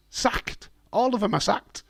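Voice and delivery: loud, high, straining outburst; voice dying. Real, not acted. Streamer ranting about sacked wrestlers, voice dying. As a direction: push it out loud until the voice gives way. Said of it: "my voice is dying again".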